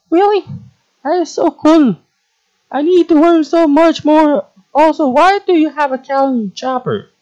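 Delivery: very high, squeaky, wide-eyed questioning; plant girl character. Acted. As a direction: pipe up high as an impressed little girl. Plays the plant girl, impressed.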